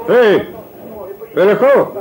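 loud high call to a customer; calling across room. Acted. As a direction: shout his name across the café. Barman calls customer across room.

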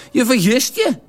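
lightly voiced Venlo lout; Venlo dialect. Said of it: "Alles steeds in vuig plat Venloos".

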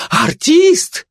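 very high, rising cry of disbelief; gossip's shrieky surprise; astonished exclamation. A narrator's reading. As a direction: exclaim it in amazement, voice shooting up. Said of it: "воскликнула сплетница".

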